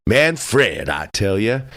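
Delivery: plain reading voice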